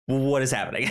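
fast, tumbling confusion; rapid bewildered babble. Retold or imitated. Retelling the confusing film to cohost, fast and bewildered. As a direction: spill the words out fast, puzzled.

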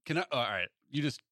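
ordinary talk

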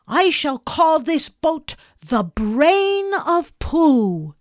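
high, loud, slow announcement; grand proclamation. Acted. Boy names the boat, loud and grand. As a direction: proclaim the name with ceremony.

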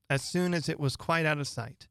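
plain reading voice

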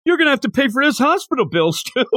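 fast, high taunting thug voice; hammed-up taunt, laughing through it; mock trash talk. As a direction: sneer it out like a street tough with a laugh at the end. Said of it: "as a trash talk".

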